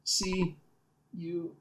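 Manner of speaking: soft, fading voice of a dying little hero; dying bee. Acted. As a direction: give final words as life slips away.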